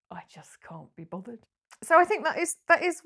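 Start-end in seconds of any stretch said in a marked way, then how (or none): 0.1-1.4 very soft, listless quote; hushed, acted-out apathy; can't be bothered. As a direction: barely voice it, as if too drained to care. Voicing women who lost interest.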